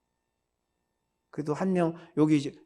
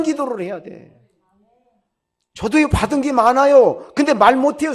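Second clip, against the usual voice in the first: fiery sermon climax, higher and louder; preaching at full force; 6 semitones higher, 7 dB louder than usual. Real, not acted. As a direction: thunder the warning at the congregation.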